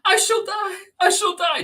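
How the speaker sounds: very high, very loud frantic wail; desperate shriek. Acted. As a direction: shriek as if dying of it. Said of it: "she shrieked".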